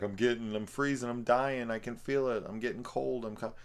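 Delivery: high, mock-panicked whine; hammed-up freaking out; hypochondriac panic. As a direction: whimper like you're sure you're dying. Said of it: "freaking out like a hypochondriac".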